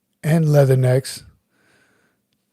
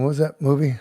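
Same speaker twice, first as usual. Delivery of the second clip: usual speaking voice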